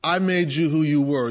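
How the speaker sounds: normal talking voice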